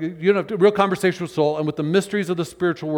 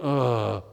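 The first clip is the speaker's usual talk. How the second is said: weary groan; 6 semitones lower, 4 dB quieter than usual. Shown as a demonstration. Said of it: "all I'm doing is going".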